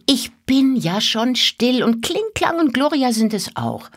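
put-on high, hurried child's plea; pleading little girl. A narrator's reading. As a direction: granddaughter begging grandfather, high and quick. Mira hushes and pleads.

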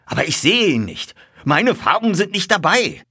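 high, loud alarm, rising in urgency; agitated. A narrator's reading. Colonel cannot see his colours, increasingly agitated. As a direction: exclaim like a worried owner. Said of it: "fragte der Oberst aufgeregt".

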